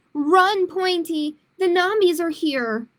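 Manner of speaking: alarmed storytime yell; panicked call. A narrator's reading. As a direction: shout the warning like they're right behind you. Dinosaur flees the nombies.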